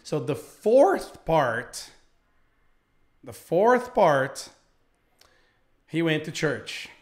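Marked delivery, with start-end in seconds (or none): none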